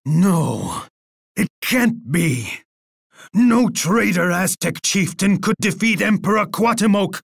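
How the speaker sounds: indignant disbelief. Acted. Emperor refuses to accept defeat.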